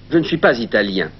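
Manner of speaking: ordinary talk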